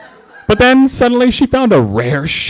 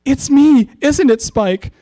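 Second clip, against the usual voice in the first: higher, excited; eager hope; 13 semitones higher, slower than usual. Acted. Twilight hoping Spike loves her, eager and excited. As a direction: burst out hopefully.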